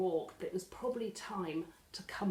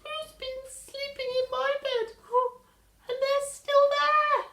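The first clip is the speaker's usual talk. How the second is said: very high, loud squeaky little bear voice; Baby Bear squeaking; 8 dB louder than usual. Acted. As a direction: squeak it like a tiny bear cub. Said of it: "he squeaked".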